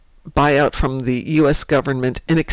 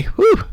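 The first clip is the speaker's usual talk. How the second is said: sharp gasp after choking; high gasping whew; 4 dB louder than usual. Real, not acted. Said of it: "bad sip, went the wrong way".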